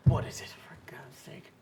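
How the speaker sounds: hushed, unvoiced exasperation; exasperated whisper. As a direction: whisper it in disbelief, very softly. Exasperated fisherman loses patience under breath.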